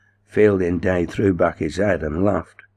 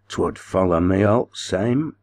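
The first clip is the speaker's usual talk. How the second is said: ordinary delivery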